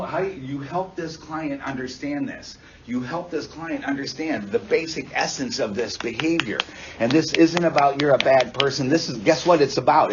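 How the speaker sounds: usual speaking voice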